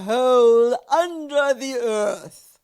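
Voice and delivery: put-on slow, loud Oxford don with a British accent; stately British-accented professor, drawing out each word. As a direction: lecture very deliberately in a British accent. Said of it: "speaking in this British accent".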